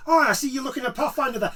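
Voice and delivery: high, eager salesman greeting; hammed-up overly keen clerk; pushy shop clerk. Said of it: "the guy comes over and he's like".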